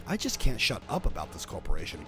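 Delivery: usual speaking voice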